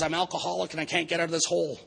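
mild mock plea for help, pitched high; trapped alcoholic. Alcoholic in a hole answers the businessman, mild high-pitched plea. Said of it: "he's screaming and he's yelling for help".

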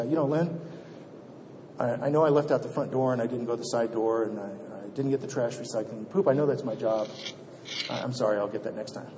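low, soft contrite confession; apologizing to his wife, low and contrite; humble apology. Retold or imitated. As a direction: sheepishly own up to your wife.

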